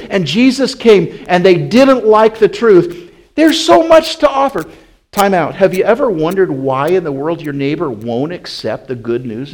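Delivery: loud, high, driving pulpit voice; full-force preaching. Acted. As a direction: preach it hard to the congregation.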